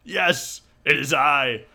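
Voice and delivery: grand, high heroic proclamation; valiant hero. Acted. Crudo answers the damsel, booming heroic declaration. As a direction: announce yourself loudly as the saviour.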